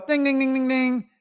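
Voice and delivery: high, ringing "ding" repeats; imitated bell. Contractor realising what the client is after, imitated bell. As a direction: chime like an alarm bell going off.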